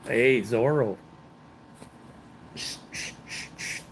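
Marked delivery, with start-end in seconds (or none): 2.6-3.8 soft breathy shh, shh, like hushing a secret; playful shushing. Pretend. Joking about Zorro with Rosa, playful shushing. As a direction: hush them jokingly.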